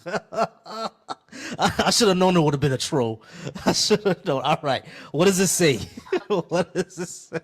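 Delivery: cracking up at being trolled; laughing. Real, not acted. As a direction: laugh while you talk. Realizes he has been trolled.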